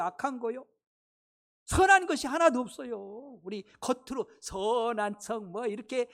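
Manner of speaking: ordinary talk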